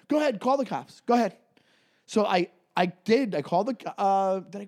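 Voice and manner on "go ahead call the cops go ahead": a hint of a defiant cabbie, higher; lightly voiced cabbie calling his bluff; cabbie daring him. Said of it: "He goes".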